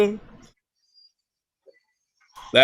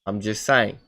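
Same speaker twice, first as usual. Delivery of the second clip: put-on English accent, insisting innocently; casual mock-English explanation. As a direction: bluff along in a fake English accent. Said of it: "fucking English".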